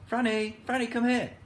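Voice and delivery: put-on high calling voice for the boy Rick; cried out. A narrator's reading. Rick calling his sister Franny over, high call. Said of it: "cried Rick".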